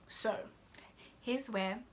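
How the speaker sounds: ordinary talk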